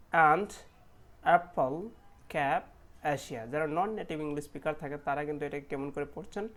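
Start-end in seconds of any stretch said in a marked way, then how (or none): 0.1-3.5 অ্যান্ড, অ্যাপল, ক্যাপ with an open a as in cat; short a sound. Shown as a demonstration. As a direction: model each word with a wide short a. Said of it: "আমি আবার পড়ে দিচ্ছি".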